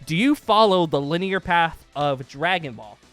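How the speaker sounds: ordinary talk, nothing marked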